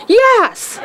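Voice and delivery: high, emphatic reenacted yes; overjoyed yes. Devotee accepting the invitation home, overjoyed. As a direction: burst out with an eager acceptance.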